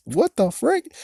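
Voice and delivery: high-pitched disbelief, rising at the end; squeaky what-on-earth reaction; incredulous. Real, not acted. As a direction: jump up high in bafflement. Reacts to chandelier detail in disbelief.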